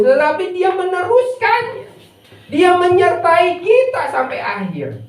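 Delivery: high, ringing sermon climax; preacher's high proclamation to the congregation; impassioned preaching. Real, not acted. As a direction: drive each promise home, then let it fall at the end.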